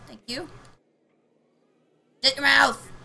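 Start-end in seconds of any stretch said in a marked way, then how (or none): 2.2-2.9 loud mock Shut your mouth!; playful shout. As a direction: shout it at him in fake anger. Yelling playfully at partner.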